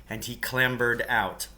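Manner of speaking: plain reading voice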